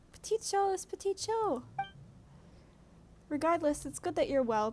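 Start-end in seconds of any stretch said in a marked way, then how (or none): 0.1-1.6 high little creature voice; cute creature voice. Acted. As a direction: squeak it like a tiny sprite. Voicing a small creature.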